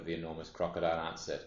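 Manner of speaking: usual speaking voice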